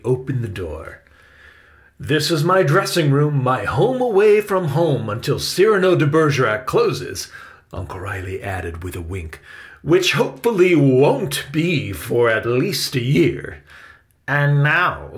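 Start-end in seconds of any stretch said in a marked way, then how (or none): 2.0-7.3 louder, higher uncle character; welcoming uncle. A narrator's reading. 9.8-13.6 louder, higher aside with a wink; playful uncle. A narrator's reading. 14.3-15.1 a touch of loud, higher cheer; cheerful. A narrator's reading. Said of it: "said a cheerful voice".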